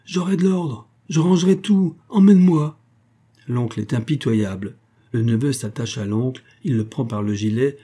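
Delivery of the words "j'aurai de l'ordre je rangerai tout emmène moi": high, increasingly loud desperate begging; pleading cry. A narrator's reading. Tony begging his uncle to take him, crying out. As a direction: cry out promises, building to a loud plea. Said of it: "il crie".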